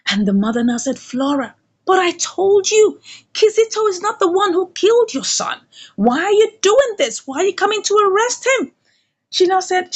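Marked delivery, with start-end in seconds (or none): 1.0-8.7 high, insistent motherly objection; acted protest. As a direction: play a mother protesting her son's arrest. Mother pleads with Flora.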